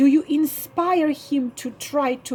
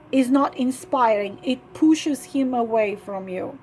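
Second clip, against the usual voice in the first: ordinary talk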